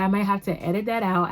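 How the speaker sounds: ordinary talk, nothing marked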